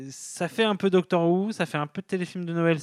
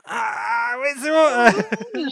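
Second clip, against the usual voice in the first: high, slow, drawn-out delight; delighted approval; 9 semitones higher, 4 dB louder, much slower than usual. Pretend. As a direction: savour it, very pleased. Mock customer approving kebab. (ravi, voix haute et traînante)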